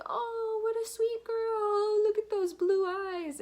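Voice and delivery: mock a stranger cooing over the baby; gushing stranger. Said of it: "they're just trying to be like".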